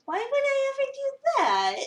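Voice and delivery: high, loud, sing-song fake innocence; mock innocent. [name] feigns innocence about posting the photos, high and loud. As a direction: protest innocence you don't mean.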